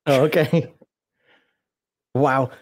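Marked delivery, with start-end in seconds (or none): none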